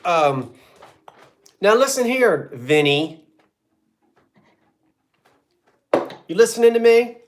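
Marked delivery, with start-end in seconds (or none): 1.6-7.2 playful scolding, pitched up; teasing telling-off; mock stern warning. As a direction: lay down the law, jokingly. Playful warning to Vinny about thrifting.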